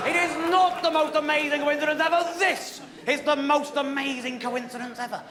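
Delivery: high, emphatic mock outrage; a touch of affronted one-upmanship; indignant correction. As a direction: correct him, pitched high. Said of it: "And I said".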